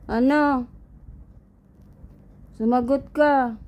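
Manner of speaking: high, punchy telling-off; stern mock demand. Owner demands silent cat answer after threat, stern mock scolding.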